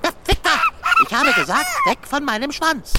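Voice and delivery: cat snapping at tiny people on his tail; angry repeat warning. Acted. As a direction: bark it the second time round.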